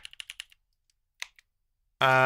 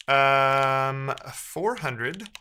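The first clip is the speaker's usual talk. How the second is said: ordinary talk, nothing marked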